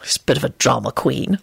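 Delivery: low, apologetic aside. A narrator's reading. Lupin excuses Scully to Neville, apologetic. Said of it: "Lupin told Neville apologetically".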